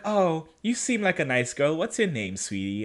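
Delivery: put-on soft, sugary old lady voice; sweet grandma. Old woman sweetly asking Bonnie's name, soft and cooing. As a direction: coo it like a kindly grandma.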